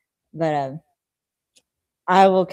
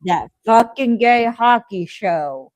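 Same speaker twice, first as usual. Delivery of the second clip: exasperated; 6 semitones higher, 5 dB louder, slower than usual. Real, not acted. Exasperated rant.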